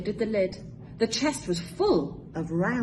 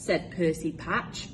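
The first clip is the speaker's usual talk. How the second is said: ordinary delivery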